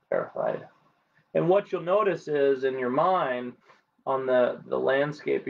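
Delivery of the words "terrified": "terrified." dropped very low and fading out; sinking emotional repeat. Shown as a demonstration. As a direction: let the last "terrified." sink deep and settle. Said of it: "Even though I'm terrified of".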